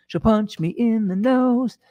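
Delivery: high, drawn-out comic repeat; mock warning. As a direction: stretch it out slowly in a silly high voice.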